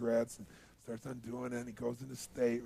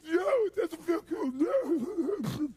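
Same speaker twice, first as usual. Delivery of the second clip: high, loud mock sobbing; hammed-up tearful protest; freaked-out crying; 5 dB louder, much slower than usual. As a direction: imitate a smoker wailing in panic. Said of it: "He's freaking out".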